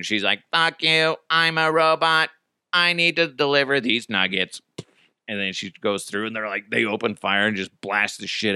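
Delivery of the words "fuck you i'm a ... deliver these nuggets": monotone robot voice; robot driver. Acted. Robot driver refusing police orders, robotic monotone. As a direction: hold every word on one level note. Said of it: "the robotic delivery driver".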